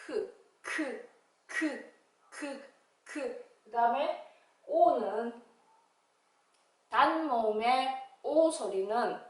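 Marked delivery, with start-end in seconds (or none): 0.1-3.4 크 said slowly and softly, a puff of air; slow k sound. Shown as a demonstration. Teacher modelling the k sound for pupils, slow and soft. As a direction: stretch out each 크 gently. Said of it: "씨".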